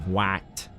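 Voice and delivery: flat low American "What?"; bland American. Retold or imitated. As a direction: say "What?" the plain American way, uninspired. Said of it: "American way of saying it".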